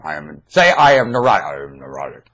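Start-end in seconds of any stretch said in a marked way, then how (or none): 0.0-0.4 low, soft answer from another self; puka voice. Pretend. Puka answering the prompt, low and soft. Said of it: "That tone of voice, that's puka". 1.4-2.3 much lower and softer, another self speaking; puka voice. Pretend. Puka answering the prompt, low and soft. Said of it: "That tone of voice, that's puka".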